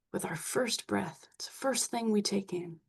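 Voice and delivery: soft unvoiced whisper about breath. Real, not acted. Speaking of the first breath.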